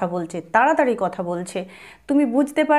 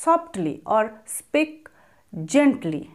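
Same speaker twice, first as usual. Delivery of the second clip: slow model phrase; much slower than usual. Shown as a demonstration. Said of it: "তো তখন তাকে এভাবে বলো".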